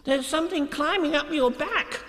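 very high excited squeal; agitated little piglet voice; crying out. A narrator's reading. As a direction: squeak the alarm out urgently. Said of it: "he cried".

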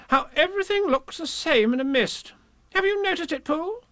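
very high character voice; puzzled Rabbit. A narrator's reading. Rabbit lost in the mist, high and puzzled.

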